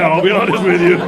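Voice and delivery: ordinary talk, nothing marked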